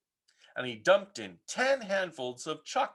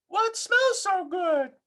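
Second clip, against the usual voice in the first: curious mother calling; 3 dB louder, slower than usual. Acted.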